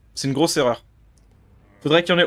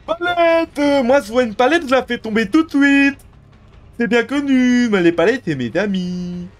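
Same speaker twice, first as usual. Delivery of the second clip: dumb bot voice; 3 semitones higher than usual. Retold or imitated. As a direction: play a brainless bot player. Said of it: "C'est des bots".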